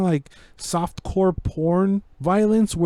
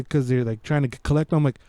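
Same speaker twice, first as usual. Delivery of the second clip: ordinary delivery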